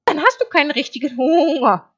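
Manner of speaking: high, loud sheep voice; naive sheep. Acted. Sheep puzzled that wolf refuses hay, high bleat. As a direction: bleat it out, high and matter-of-fact.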